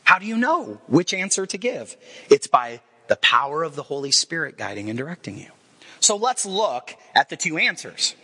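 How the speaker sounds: ordinary talk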